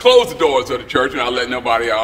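hammed-up preacher at the pulpit, calling out loud. Preacher voiced in church story.

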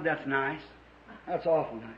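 very low, soft acknowledgement; subdued polite reply. Retold or imitated. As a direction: drop right down, quiet and noncommittal. Recalls his own subdued polite reply.

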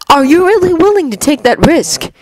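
cold god Loki. Acted. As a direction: lay out the plan like a calm schemer. Loki voiced by reader.

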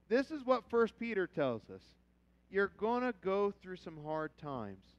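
ordinary delivery

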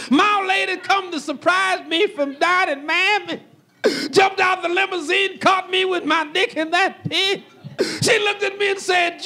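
normal talking voice

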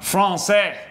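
drawn-out mock French pronunciation; bad French accent. Co-host introducing a segment about France, bad French accent. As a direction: attempt a French accent, badly. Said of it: "I'm rubbish at accents".

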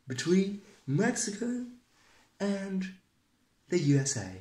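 a loud mock Donald Trump speech; Trump impression. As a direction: boast like a president at a podium. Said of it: "how to do Donald Trump voices".

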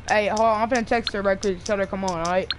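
ordinary delivery

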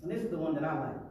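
normal talking voice

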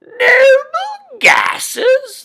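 very high, slow, nose-pinched royal voice; pinched nose snooty. Shown as a demonstration. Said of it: "now pinch your nose now".

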